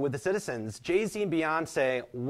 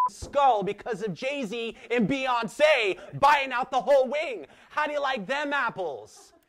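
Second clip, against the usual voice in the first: mock indignant shout; 10 semitones higher, slower than usual. Mother blaming [name] and [name] to her child, in a high mock shout. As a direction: yell it out like a fed-up Southern mom. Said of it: "here's a story that you'd be telling your soon-to-be child".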